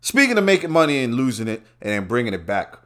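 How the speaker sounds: ordinary talk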